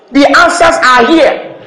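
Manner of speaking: slow, stressed answers are here; "answers" rising, held slow; emphatic. Acted. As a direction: lean on "answers", drawn out. Preacher stresses slowly.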